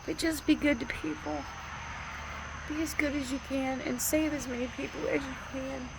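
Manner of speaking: high, tearful voice pushing through advice; fighting tears to finish the thought; still emotional. Real, not acted. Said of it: "Sorry, I'm getting emotional".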